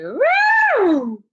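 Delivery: very high, loud woo; high whooping glide; voice thrown high. Shown as a demonstration. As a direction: swoop the voice up high. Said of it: "throw my voice up in the air".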